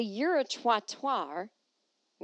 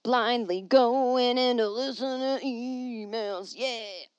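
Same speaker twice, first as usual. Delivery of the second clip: slow, louder announcer read; mock announcer; 3 semitones higher, 5 dB louder, much slower than usual. She dramatically announces picking emails blind, slow and loud. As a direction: announce it like a show segment, slowly.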